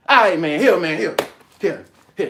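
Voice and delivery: loud, fed-up giving in; loud fed-up man giving in to a beggar; exasperated giving in. Retold or imitated. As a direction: throw him the coins and be done with it. Giver gives in loudly.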